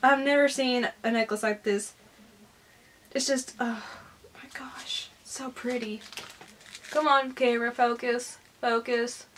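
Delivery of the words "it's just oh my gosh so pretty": very soft, fading into a whisper; awed hush. Real, not acted. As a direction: breathe it out in wonder. Awed by necklace gift.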